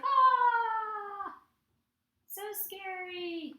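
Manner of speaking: high drawn-out pretend fright; mock scared. Teacher drawing a scared face, mock fright. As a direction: let out a high, playful scared cry. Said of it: "like, a scared person's face".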